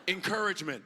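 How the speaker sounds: ordinary delivery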